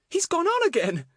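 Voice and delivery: put-on high, loud, amused child voice; giggly. A narrator's reading. Betts watching the man walk on, giggly. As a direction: exclaim it high, tickled by the sight. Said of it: "said Betts with a giggle".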